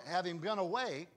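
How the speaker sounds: normal talking voice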